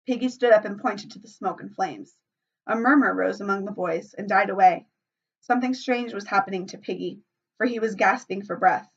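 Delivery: ordinary delivery, nothing marked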